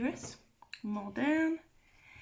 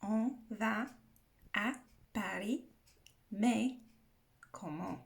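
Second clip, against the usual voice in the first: word by word, with clear gaps; slowed down for learners. Shown as a demonstration. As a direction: break "On va / à Paris / mais / comment" into separate careful pieces. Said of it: "saying that a bit fast".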